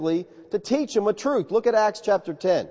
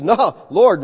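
louder "No, Lord", flatly refusing; loud refusal; 2 semitones higher, 7 dB louder than usual. Acted. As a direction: throw back a firm no to God. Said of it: "But Peter says".

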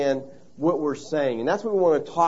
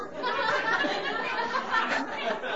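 laugh; 8 dB quieter than usual. Real, not acted. Laughing at the misunderstanding.

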